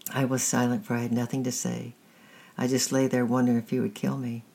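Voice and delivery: ordinary delivery, nothing marked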